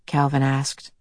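usual speaking voice

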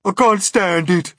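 a high, loud fearful wail. Acted. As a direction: cry out in terror. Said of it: "wailed the earthworm".

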